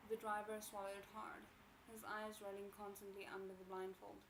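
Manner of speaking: ordinary delivery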